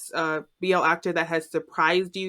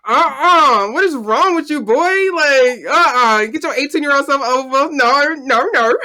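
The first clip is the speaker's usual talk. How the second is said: higher, louder, playful telling-off; mock scolding; 4 semitones higher, 5 dB louder than usual. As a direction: scold the boy in pretend outrage. Mock scolding of eighteen-year-old actor.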